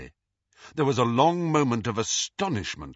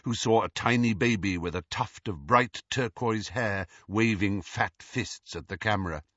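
plain reading voice

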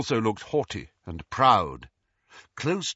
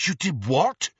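raised, incredulous question; stunned; 6 semitones higher, 4 dB louder than usual. A narrator's reading. Harry hears the ghost's confession, incredulous. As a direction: ask as if you misheard.

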